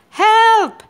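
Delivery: high, loud cry for help; shouting for help. A narrator's reading. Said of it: "Cat was shouting".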